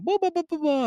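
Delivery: high-pitched babbling burst; comic torrent of nonsense syllables; mock rapid-fire argument. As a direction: babble high, as if overwhelming an opponent. Imitates himself coming at them hard.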